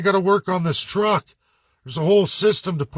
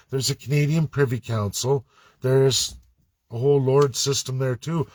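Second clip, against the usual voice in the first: ordinary talk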